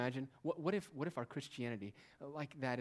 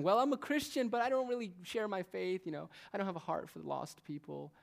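higher whiny voice of a lukewarm believer; mock excuse; 6 semitones higher, 5 dB louder than usual. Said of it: "If you're arguing".

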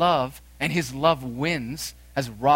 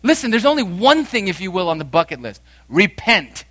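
loud, high, pressing sermon voice; a preacher crying out to turn from sin; full-force preaching; 8 semitones higher, 8 dB louder than usual. Acted. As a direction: urge them to repent at full force. Preacher exhorting congregation.

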